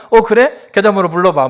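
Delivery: high, loud ready response; obliging father. Acted. Father answering his son's call for help, eagerly obliging. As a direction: jump in readily as the helpful parent. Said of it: "봉천동에 있는 아버지가".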